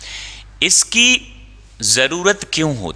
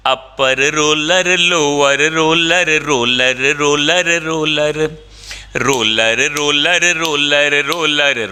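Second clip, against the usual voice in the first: slow, sing-song roller drill with each word stretched out; tongue twister drill; 4 semitones higher, slower than usual. Shown as a demonstration. Teacher drilling a tongue twister for the students, in a slow sing-song. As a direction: chant the roller words like a tongue twister exercise. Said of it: "tongue twister drill".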